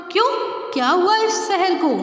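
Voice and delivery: a startled mother asking what is wrong with the city; surprised. Acted. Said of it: "माँ को आश्चर्य हुआ".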